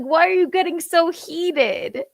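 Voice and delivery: high-pitched incredulous retort; amused disbelief. Retold or imitated. Giggling child answering a screaming mom, incredulous. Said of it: "I'm like".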